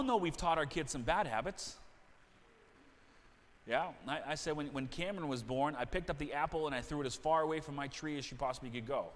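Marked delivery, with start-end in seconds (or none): none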